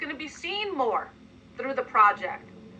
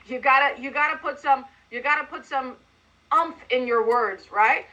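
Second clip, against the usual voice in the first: ordinary talk, nothing marked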